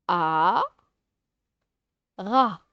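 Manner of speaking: "A, ra" split with a long pause; syllable by syllable. Shown as a demonstration. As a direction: say "A", wait, then "ra", each firm and loud. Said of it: "je mets les syllabes". (« A, ra » en détachant bien les syllabes)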